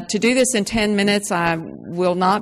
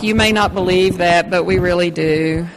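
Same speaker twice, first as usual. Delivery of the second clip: ordinary delivery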